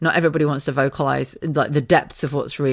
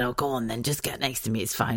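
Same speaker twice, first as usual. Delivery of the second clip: much softer, resigned murmur; sleepy soft giving in; 5 semitones lower, 9 dB quieter than usual. Retold or imitated. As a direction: give in quietly, half asleep. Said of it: "and I said".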